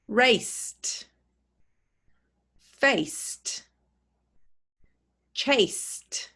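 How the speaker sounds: raced ending in a voiceless t. Shown as a demonstration. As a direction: end each word on a crisp t. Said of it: "we hear the t".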